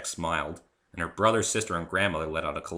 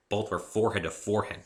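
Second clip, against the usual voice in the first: ordinary delivery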